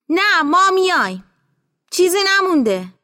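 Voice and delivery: high, loud call; calling out a refusal. Acted. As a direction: turn down the ride, voice carrying. Calling refusal to tractor driver.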